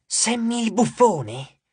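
amused undertone. A narrator's reading. Small pupil giggles about the class clown, amused. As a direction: draw it out in mild amusement. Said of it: "ridacchiò e disse sottovoce".

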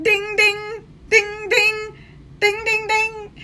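ping like a phone alert; notification dings. Retold or imitated. Mimicking his phone flooding with messages, high dings. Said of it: "He's gonna be like".

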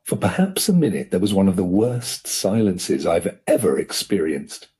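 ordinary delivery

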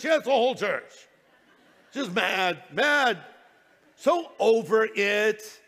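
loud, hammed-up fed-up churchgoer; mock mad. Preacher imitates someone mad at the whole church, loudly fed up. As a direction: imitate someone mad at everyone, hitting each word hard. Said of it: "we're sort of mad at God".

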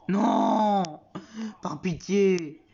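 loud, high cry of despair; desperate pleading. Acted. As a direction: beg loudly for mercy, hammed-up. Husband begs wife not to.

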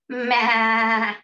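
loud, quavering "maa"; goat bleat. Shown as a demonstration. Teacher asking children to copy the goat, goat bleat. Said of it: "make the sound of that goat".

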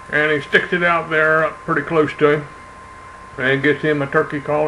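ordinary talk, nothing marked

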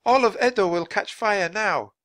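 high-pitched woman's alarm; crying out. A narrator's reading. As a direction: cry out the dreadful warning. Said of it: "cried a woman".

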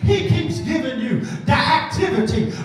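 rhythmic pulpit listing; preaching. Acted. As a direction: preach the list with fire. Preaching litany.

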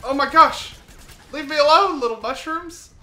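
high, loud panicked yell; panicked shout. Real, not acted.

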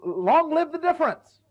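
a glad pulpit proclamation; proclaiming. Real, not acted. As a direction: ring it out like a motto. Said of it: "translation proclaimed".

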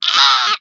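loud playful scream; screaming for fun into the mic; scream into microphone. Pretend. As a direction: let out a big silly scream. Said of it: "I'm just gonna scream into the microphone".

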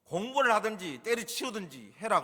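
exasperated parent laying down an ultimatum; scolding parent. Acted. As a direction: scold a child caught gaming. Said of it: "게임하는 현장을 보면 뭐라고 얘기합니까".

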